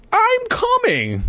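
very high, drawn-out call. Acted. Boy calls to his sister that help is on the way, calling out. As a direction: sing it out long and high. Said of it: "I called".

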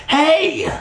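very high, sudden yelp; cry of alarm. Acted. Kid as his friend gets blocked, high alarmed cry. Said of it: "I cried".

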